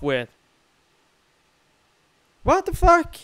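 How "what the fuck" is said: high loud angry outburst; frustrated yelling. Real, not acted. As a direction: shout in frustration. Frustrated panic.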